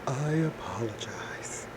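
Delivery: soft, breathy aside; whispered apology. Real, not acted. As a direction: drop out of the reading to whisper sorry. Breaks off the deep reading.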